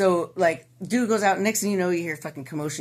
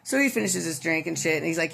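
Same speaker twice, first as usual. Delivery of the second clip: normal talking voice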